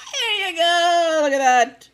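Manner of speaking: high, loud laughing shout; mocking shout. Real, not acted. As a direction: crow it out with a laugh. Mocking shout at own mistake.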